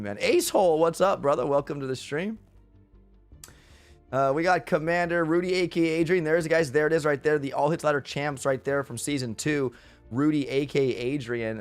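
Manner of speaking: ordinary talk, nothing marked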